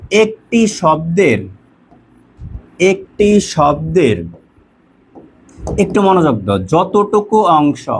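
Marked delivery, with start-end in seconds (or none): 0.1-4.2 drawn-out words at half speed; slow, spaced dictation with held syllables; dictation pace. Shown as a demonstration. Teacher dictating a definition to students, slow dictation. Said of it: "লেখো". 6.6-7.9 slow, stretched-out words; dictation pace. Shown as a demonstration. Teacher giving students a line to write down, slow dictation. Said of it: "লিখে নিবা".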